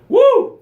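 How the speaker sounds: high loud whoop; excited woo. Real, not acted. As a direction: whoop with energy. Energized host whoops.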